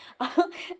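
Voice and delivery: short high laugh; real giggle breaking into the story; amused laugh. Real, not acted. Storyteller tickled by her own dream, laughing. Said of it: "मुझे हँसी भी आ रही है".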